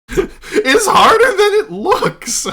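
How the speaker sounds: loud, high, slow laughing wail; laughing protest. Real, not acted. Game master defending the botched accent, loudly laughing. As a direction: squeal it out through real laughter.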